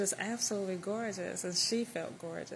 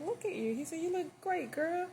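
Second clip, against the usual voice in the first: delighted greeting; 9 semitones higher than usual. Retold or imitated. Old friend greets her warmly.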